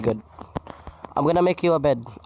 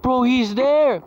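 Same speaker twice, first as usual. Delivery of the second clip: urgent warning; 8 semitones higher, 4 dB louder, much slower than usual. Real, not acted. Warning friend of enemy.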